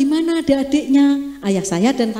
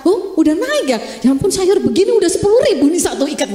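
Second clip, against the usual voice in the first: shocked shopper; 8 semitones higher, 4 dB louder, faster than usual. Pretend. Shocked at market prices.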